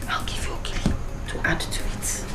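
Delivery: hushed, secretive instructions; conspiratorial whisper. Acted. Mother whispering to her daughter how to win over Fred, hushed and secretive. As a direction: whisper it so no one overhears.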